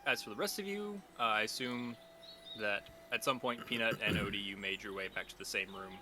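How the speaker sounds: calm scene-setting narration; game master narration. A narrator's reading. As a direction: set the morning scene softly.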